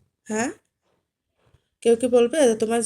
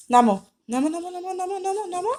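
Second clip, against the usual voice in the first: high coaxing plea; 9 semitones higher than usual. Real, not acted. Begging pet to get down.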